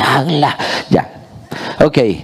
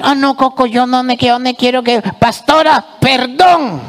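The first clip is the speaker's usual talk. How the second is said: squeaky pleading puppet, much higher; pitched-up nervous puppet pleading; 9 semitones higher than usual. Acted. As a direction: whine it like a scared little character. Puppet character protests in high voice.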